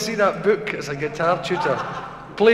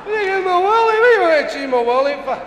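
incredulous protest; 11 semitones higher, 4 dB louder, faster than usual. Acted. Deaf patient objects to doctor's order, incredulous protest. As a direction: protest in rising disbelief.

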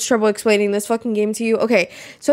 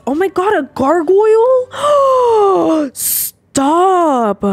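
high squeal of delight at the find; gasping excited squeal; excited shriek; 9 semitones higher, 4 dB louder, much slower than usual. Real, not acted. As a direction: shriek it out, thrilled. Finding a gargoyle, shrieks.

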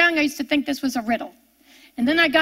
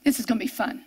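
wry aside; 4 semitones lower, 5 dB quieter, much faster than usual. Real, not acted.

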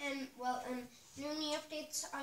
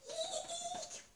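high squeak of fake fright; mock squeal of fright; 4 dB quieter than usual. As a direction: squeal as if startled. Pretend fright.

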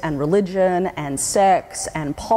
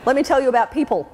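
cranky; 6 semitones higher than usual. Retold or imitated. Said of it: "a small, cranky feminist Australian".

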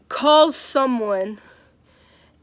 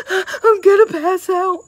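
"I'm gonna pass out" blurted higher and louder, mock panic; panicked; 8 semitones higher, 5 dB louder than usual. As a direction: replay the faint-panic in a strained put-on voice. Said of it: "freaking out and, like".

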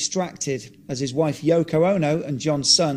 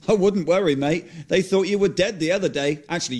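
ordinary delivery, nothing marked

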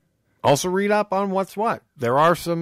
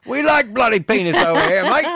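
hammed-up British accent, higher; terrible British accent; 6 semitones higher, 3 dB louder than usual. Answering for the Brits, deliberately bad British accent. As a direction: play a cartoon Brit, badly. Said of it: "It's a terrible British accent".

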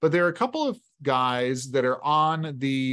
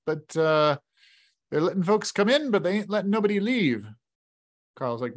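raised, folksy barkeep with a grim edge; chuckling innkeeper delivering bad news; innkeeper voice, higher; 4 semitones higher than usual. Innkeeper warns the boys nobody leaves town, folksy and grim.

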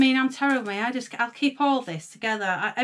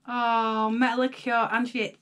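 ordinary talk, nothing marked

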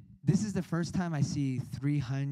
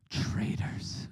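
hushed whisper of betrayal; whispered jab. Retold or imitated. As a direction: whisper it at friends leaving. Friends walk to altar.

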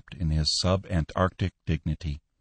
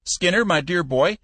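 put-on high, louder old man pleading and scolding; scolding old Cappy; 12 semitones higher, 4 dB louder than usual. Old boss scolding his manager, high and loud. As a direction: rib him like a cranky old boss.

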